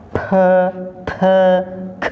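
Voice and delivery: "P", "T", "K" each as a puffed schwa syllable; isolated breath bursts on "P, T, K"; explosive aspiration. Shown as a demonstration. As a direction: pop air out on "P, T, K". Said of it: "itu ada ledakannya".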